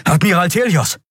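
high, urgent call; calling the admiral. A narrator's reading. Offizier ruft seinen Admiral. (hoher, dringender Zuruf)

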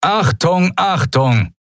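high-pitched urgent alarm call; thundering announcement. A narrator's reading. Guard alerts the base over hidden loudspeakers, thundering announcement. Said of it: "donnerte plötzlich die Stimme eines Menschen über versteckte Lautsprecher".

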